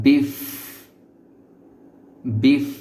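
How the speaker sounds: বিফ ending in a held, airy f; teeth-on-lip f. Shown as a demonstration. Teacher models an important sound, teeth-on-lip f. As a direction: bring upper teeth to lip and blow the f of বিফ. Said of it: "এই দাঁত ঠোঁটের উপরে নিয়ে আসতে হবে".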